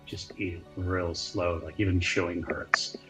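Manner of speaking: ordinary talk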